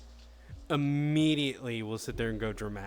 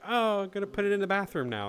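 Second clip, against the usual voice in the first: higher, lightly voiced prankster ghost; mischievous ghost; 6 semitones higher than usual. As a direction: pretend innocent troublemaker. Plays the ghost moving things.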